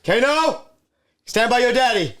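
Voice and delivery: high, loud wrestler's taunt; wrestling promo shout. Pretend.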